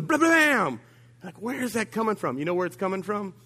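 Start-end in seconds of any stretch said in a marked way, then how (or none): 0.0-0.8 high, loud blam like a blowup; hammed-up verbal explosion; mock explosion. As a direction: burst out with a comic explosion. Imitating an explosion.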